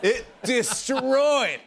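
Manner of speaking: high, loud, drawn-out rave; excited raving. Real, not acted. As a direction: stretch it out, high and loud with a laugh. Raving about Backstreet Boys' set.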